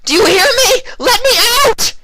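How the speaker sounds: a very high, loud, crying scream. A narrator's reading. Vinyl screaming at the window, desperate. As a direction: scream it, banging on glass. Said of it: "she screamed".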